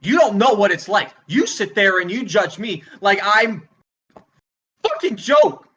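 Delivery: furious rant, flaring into shouts; angry tirade. Acted. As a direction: lash out at the whole crowd. Comedian loses temper at audience.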